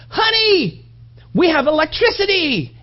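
high thrilled announcement; excited husband. Retold or imitated. Husband telling his wife about the light, high thrilled call. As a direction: call to her in mock wonder. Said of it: "and he goes".

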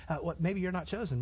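normal talking voice

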